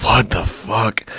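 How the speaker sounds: slow, stretched-out disbelief; mock stunned reaction. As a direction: drag it out, dumbfounded. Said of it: "and I was like".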